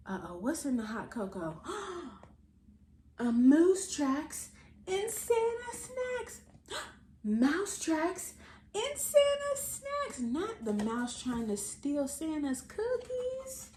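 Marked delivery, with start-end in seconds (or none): 3.2-6.4 higher, louder, slow reveal. Acted. Auntie revealing the answer to the children, slow and excited. 7.2-10.2 slow reveal. Acted. Auntie revealing the answer to the children, slow and excited.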